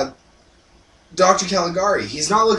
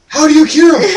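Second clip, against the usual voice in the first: high, loud exasperated-viewer yell; mock outcry; 14 semitones higher, 13 dB louder than usual. Viewer reacting to the abrupt ending, mock outcry. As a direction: yell the question high, hammed-up.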